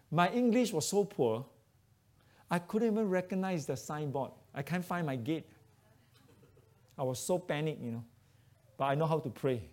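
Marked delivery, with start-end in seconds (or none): none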